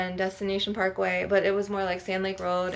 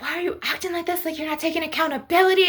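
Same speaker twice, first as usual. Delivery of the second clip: put-on fast, high, raging quote of the boyfriend; imitating his rage; 7 semitones higher, much faster than usual. Boyfriend raging at her on the phone, fast and high-pitched. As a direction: rattle it off high and fast like he's raging at you. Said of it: "rage on me and be like".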